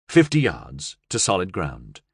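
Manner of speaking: plain reading voice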